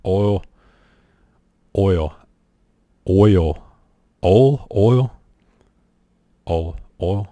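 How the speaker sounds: "oil" said over and over, each take shifting; trying out oil. Shown as a demonstration. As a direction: keep testing "oil" until it sounds right. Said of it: "pronounce these words".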